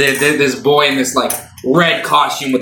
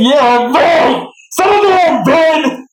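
high crying shout; 5 dB louder, slower than usual. Acted. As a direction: cry out loud and high. GM shouts in character.

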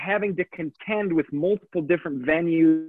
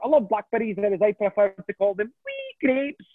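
a light, higher Scottish lilt for a one-liner; Scottish joke voice; 5 semitones higher than usual. Pretend. Comedian doing a one-liner in a Scottish accent. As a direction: tell the gag in a Scottish character voice. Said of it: "segue out of that and just have a normal voice".

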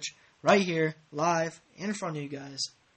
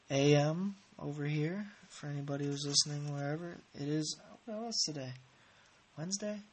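ordinary talk

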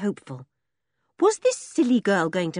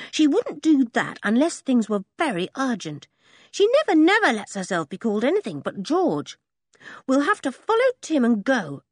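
put-on higher, urgent boy; boy William, urgent; 6 semitones higher than usual. Boy urging Henry to follow the dog, higher urgent voice. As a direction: press for action.

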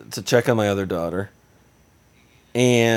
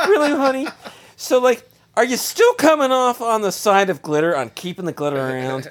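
sarcastic jab; 7 semitones higher than usual. Retold or imitated. Needling wife with I-told-you-so.

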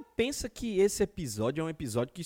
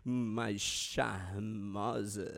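mock charming; 7 semitones lower, slower than usual. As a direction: drawl out the compliment sweetly.

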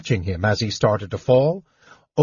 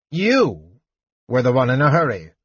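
severe. A narrator's reading. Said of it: "Nosh said severely".

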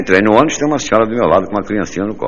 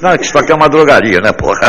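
1 exasperated shopkeeper; 7 semitones higher, 6 dB louder than usual. Retold or imitated. Annoyed shopkeeper punchline.

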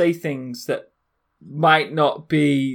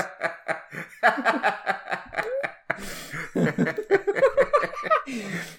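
drawn-out fit of giggling; long helpless laugh; 4 semitones higher, 4 dB quieter than usual. Real, not acted. Laughing at mate demanding no more snapshots, helpless laughter. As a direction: laugh on and on, unable to stop. Cracking up at friend's complaint.

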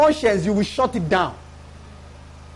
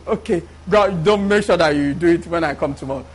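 father relenting; 5 semitones lower than usual. Acted. Father answering apologetic child, acted.

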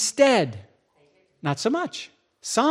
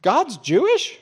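higher, astonished; surprised question; 7 semitones higher, 4 dB louder, slower than usual. Retold or imitated. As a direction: ask it in sudden amazement. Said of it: "he goes".

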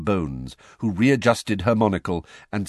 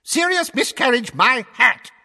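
much higher, louder scornful yell; top of his voice; 7 dB louder than usual. A narrator's reading. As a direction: bellow the scorn across the room. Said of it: "at the top of his voice".

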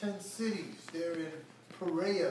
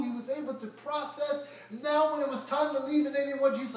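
fervent preaching; 7 semitones higher, 6 dB louder than usual. Acted. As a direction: preach it out to the crowd. Preaching at full force.

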